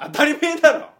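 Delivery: high, loud comic outburst; tsukkomi retort. Acted. Comedian answering a greeting about his wife, shouted tsukkomi. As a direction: shout it straight back.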